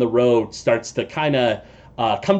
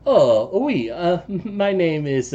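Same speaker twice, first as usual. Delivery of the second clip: put-on light French-accented halfling, a touch hesitant; a lightly French-accented little traveler introducing himself; 5 semitones higher, slower than usual. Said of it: "like your accent".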